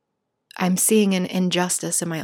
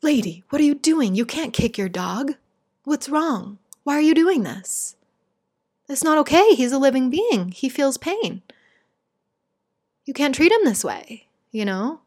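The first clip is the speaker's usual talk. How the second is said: wrathful compassion; 6 semitones higher than usual. Shown as a demonstration. To neighbor kicking her dog, wrathful compassion. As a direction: scold the neighbor firmly with heart. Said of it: "Wrathful compassion is".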